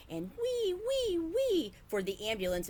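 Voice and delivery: high siren wails; siren wee. A narrator's reading. Boy playing with toy cars, high siren noise. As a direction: wail like a toy ambulance. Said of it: "making noises with his mouth".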